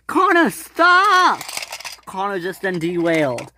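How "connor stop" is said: very high, loud, drawn-out yell; urgent shout. Acted. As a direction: yell a warning, stretching each word. Shouting warning before a derailment.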